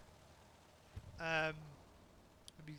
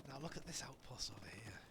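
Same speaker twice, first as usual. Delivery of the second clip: hushed sneaky whisper, much softer; secretive caster whisper pointing out a hidden spot; 5 semitones lower, 9 dB quieter than usual. Acted. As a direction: whisper it like you're creeping up on someone. Caster sneaking onto hidden outpost.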